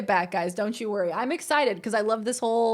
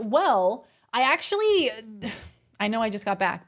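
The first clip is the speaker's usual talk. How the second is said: ordinary delivery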